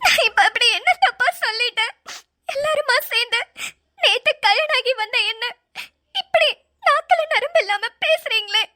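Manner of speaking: fake crying. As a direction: turn on crocodile tears to win sympathy. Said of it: "இன்னும் கொஞ்சம் performance போடு".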